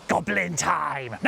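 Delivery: slow, drawn-out goblin call. Pretend. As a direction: announce it like a gleeful goblin. Announcing the game in goblin voice.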